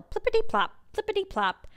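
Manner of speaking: high bouncy plippity-plop; rain sound effect. A narrator's reading. Narrator making the rain on the roof, high and bouncy. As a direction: pop each plippity-plop like raindrops.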